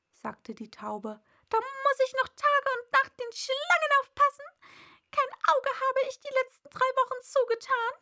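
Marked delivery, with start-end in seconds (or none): 1.5-7.9 high, loud pigeon lament; exasperated bird's complaint; distraught pigeon. A narrator's reading. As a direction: pile up the grievances in an agitated bird voice.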